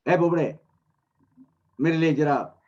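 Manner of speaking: acted call across to a house. Baba calls to Nandram's wife for a dish, calling out.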